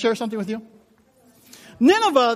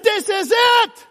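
dismayed shout; 12 semitones higher, 6 dB louder, much slower than usual. Retold or imitated. Arriving at bare room.

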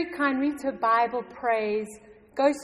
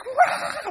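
mock scream; 14 semitones higher, 5 dB louder than usual. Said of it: "And she's screaming on the phone".

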